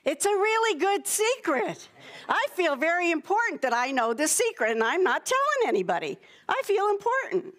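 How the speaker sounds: high, boastful singsong teasing; smug secret keeper. Acted. Showing children how keeping a secret feels, self-important singsong. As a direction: play someone puffed up with a secret.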